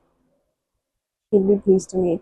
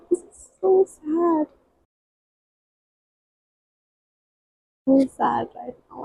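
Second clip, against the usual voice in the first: real crying; 5 semitones higher, 4 dB quieter than usual. Real, not acted. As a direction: let the tears catch in the voice as you say it. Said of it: "I'm actually crying".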